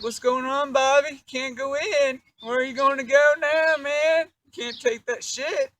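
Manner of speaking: high, loud, mocking taunt. Taunting locked-out Bobby.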